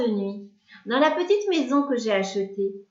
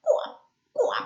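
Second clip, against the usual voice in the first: frog croaking. A narrator's reading. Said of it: "La grenouille dit".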